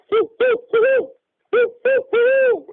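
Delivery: high hooting owl calls, loud. Retold or imitated. Mimicking the owl heard on night nine, high loud hoots. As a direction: hoot like a barred owl in the dark. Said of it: "like a hoot owl".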